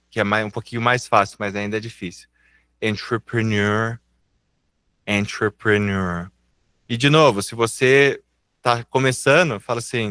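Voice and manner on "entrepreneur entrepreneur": slow, careful "Entrepreneur", slightly low; slow demonstration. Shown as a demonstration. Teacher repeating a difficult word for beginners, slowly. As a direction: stretch the word out for repetition. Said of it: "Eu vou falar devagar".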